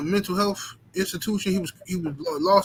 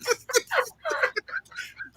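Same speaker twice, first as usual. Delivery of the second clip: high wheezing laugh; 4 dB quieter than usual. Real, not acted. Laughter trailing off.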